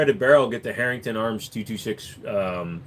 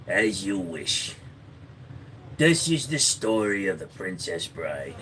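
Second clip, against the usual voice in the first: slow, hushed fairy-tale narration; storyteller voice; 3 semitones lower, 3 dB quieter, slower than usual. Pretend. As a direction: savour each word like a bedtime story.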